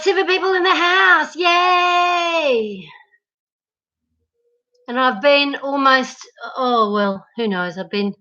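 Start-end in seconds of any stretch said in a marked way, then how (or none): 0.0-2.9 high, sing-song celebration; excited cheer. Real, not acted. Streamer celebrating people in the house, sing-song cheer. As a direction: cheer it high, stretching out the final word. Celebrating her viewer count.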